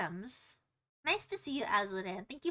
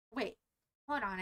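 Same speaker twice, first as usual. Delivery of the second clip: ordinary talk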